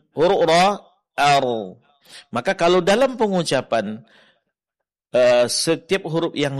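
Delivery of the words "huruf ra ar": "ar" half held, louder; ra, middle quality. Shown as a demonstration. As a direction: demonstrate the in-between "ar". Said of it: "tidak terlalu dilepaskan".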